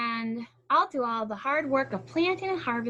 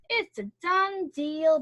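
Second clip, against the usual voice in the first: sly pleased hare; 7 semitones higher, 6 dB louder, slower than usual. Acted. Hare sealing sly deal.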